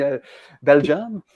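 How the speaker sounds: usual speaking voice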